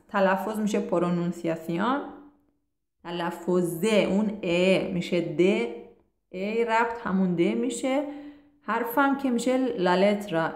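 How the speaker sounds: normal talking voice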